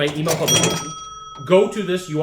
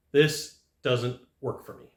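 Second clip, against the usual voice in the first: slow, low and softer, word by word; straight-up refusal; 4 semitones lower, 5 dB quieter, slower than usual. Shown as a demonstration. Salesman demonstrating refusal number six, slow straight-up delivery. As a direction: space out each word, matter-of-fact. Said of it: "Just straight up".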